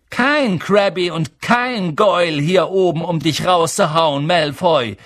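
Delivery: loud, measured challenge; rufen. A narrator's reading. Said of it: "rief Harry". (laut und herausfordernd gerufen)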